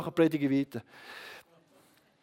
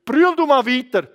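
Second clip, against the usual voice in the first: loud, raised-pitch urging; forceful exhortation; 5 semitones higher, 6 dB louder than usual. Retold or imitated. Preacher urging the weeping man onward, forcefully. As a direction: push him on, building higher.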